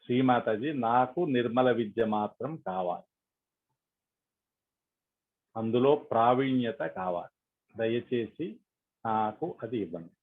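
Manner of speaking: measured affirmations with long silences; guided meditation prayer. Acted. Leader voicing affirmations for meditators to repeat, calm and prayerful. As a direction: lead them phrase by phrase, leaving space to repeat.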